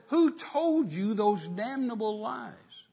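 high, very slow outraged demand; retold anger. Man denouncing lies told to his fiancée, retold slow outrage. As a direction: drag out the indignant question as you re-enact it. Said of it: "It just made me so angry".

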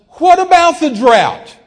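high, slow bellow; incredulous yell. Retold or imitated. Guest challenging the timid preacher, incredulous yell. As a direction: fire the question back at full volume.

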